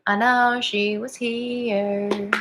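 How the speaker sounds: very slow, stretched-out words; drawn-out searching call. Real, not acted. Reader hunting for a missing viewer, drawn out. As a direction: draw every word out, softly.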